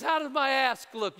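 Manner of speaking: loud, playful wife's question; wife asking. Retold or imitated. Wife asks her husband, loud and teasing. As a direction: ask it like a wife fishing for a compliment. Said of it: "your wife looks at you and says".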